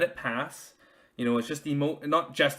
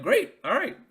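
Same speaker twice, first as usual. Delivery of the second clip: higher, louder sheepish okay; mock relief; 11 semitones higher, 7 dB louder than usual. Husband answering his wife's praise, higher and louder sheepish okay. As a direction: brightly accept the verdict. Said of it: "I'm like".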